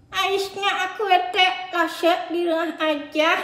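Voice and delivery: put-on a whiny little boy who keeps complaining; sulky puppet boy. Puppet Adit voice.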